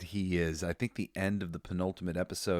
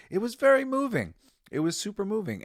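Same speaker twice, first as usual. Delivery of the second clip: much higher, emotional gush; moved; 12 semitones higher, 3 dB louder than usual. Real, not acted. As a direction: gush about it, voice lifted. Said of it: "still moved by scene".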